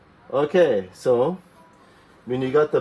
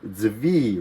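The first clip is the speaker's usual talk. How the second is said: usual speaking voice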